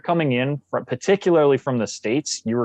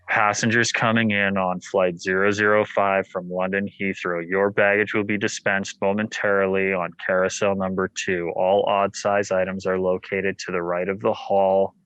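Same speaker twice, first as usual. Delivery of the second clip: lower, monotone airline announcement; bored professional announcer; 5 semitones lower than usual. Retold or imitated. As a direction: drone it like an uncaring airport PA. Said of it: "whenever they would do their professional broadcasts".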